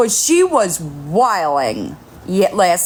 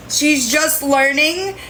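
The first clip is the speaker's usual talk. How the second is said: usual speaking voice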